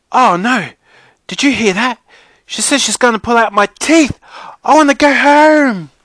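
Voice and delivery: put-on high, loud little-kid wail; panicked little girl. A narrator's reading. Brianna panics that her teeth will be pulled, wailing in panic. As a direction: wail it like a terrified small child.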